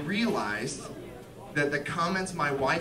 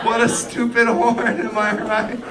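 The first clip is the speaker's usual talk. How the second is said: louder, slightly raised, forced chumminess; awkward appeasing joke; 4 semitones higher, 6 dB louder than usual. Acted. Driver waving to excuse his horn, forced joviality. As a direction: laugh off the rudeness, a bit too loudly. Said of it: "try to wave to make it better".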